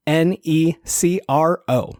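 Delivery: usual speaking voice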